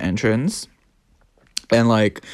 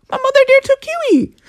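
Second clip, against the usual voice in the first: mock whine. Complaining mother took the dog.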